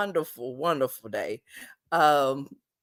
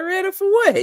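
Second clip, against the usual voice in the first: mock curious wife; 13 semitones higher, 5 dB louder than usual. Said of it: "She like".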